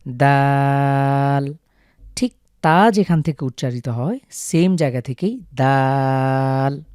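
দাল drawn out very slowly and firmly; Arabic dal. Shown as a demonstration. Teacher repeating দাল for viewers, long and slow. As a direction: hold the আ in দাল. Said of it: "অনেকটা এরকম".

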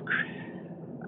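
short breathy burst of laughter; can't hold the laugh in; real laugh. Real, not acted. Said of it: "I still can't say it without laughing".

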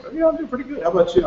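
high, chirpy voice for the woman who answers; cheery woman. Retold or imitated. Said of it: "she's like".

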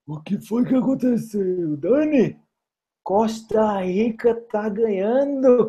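high-pitched, drawn-out theatrical surprise; mock astonishment. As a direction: play up the shock at a surprise leader. Reage surpreso à liderança da Costa Rica. (espanto teatral, voz aguda e arrastada)

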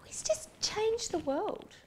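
mock earnest. Real, not acted. Earnest praise of Jamie Oliver.

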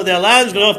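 ordinary talk

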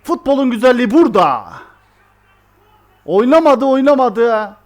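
loud, high excited cheering; thrilled celebration. Real, not acted. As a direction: shout with joy at the late goal. Late winning goal celebrated. (son dakika golüne coşkuyla bağırış)

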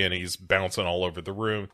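ordinary talk, nothing marked

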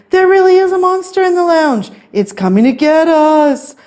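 high-pitched frantic plea; panicked yell. A narrator's reading. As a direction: insist in storybook terror. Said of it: "yelled Stanley".

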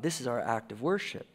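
ordinary delivery

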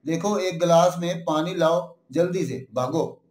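plain reading voice

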